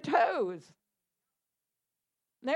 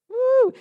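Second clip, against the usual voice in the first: whooping woo; 9 semitones higher, 8 dB louder than usual. Real, not acted. Reacting to the list of curses.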